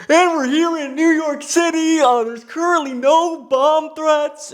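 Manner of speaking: high, bright mock TV-host voice; Ryan Seacrest. Ryan Seacrest announcing to viewers on New Year's, mock host voice. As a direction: play the phony New Year's host reading the news. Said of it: "fucking straight face going".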